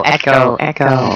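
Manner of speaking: fake echo. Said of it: "That's a joke".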